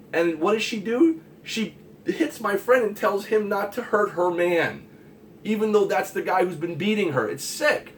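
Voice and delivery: high, loud indignation; outraged. Real, not acted. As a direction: let the disgust rise. Outraged retelling.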